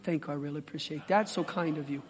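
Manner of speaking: mock-polite low murmur; flat polite thanks. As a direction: thank them like it means nothing. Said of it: "You're not gonna be".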